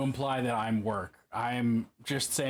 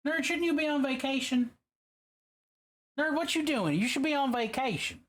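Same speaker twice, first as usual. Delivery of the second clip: high, playful squeak; squeaky voice; 12 semitones higher than usual. Shown as a demonstration. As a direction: ask it in a high silly voice. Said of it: "I'm saying it like that".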